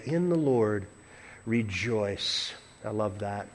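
low, soft, savoring close; hushed reflection. Real, not acted. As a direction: let it sink down very quietly. Quiet close of the point.

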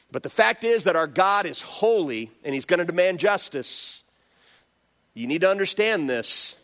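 ordinary talk

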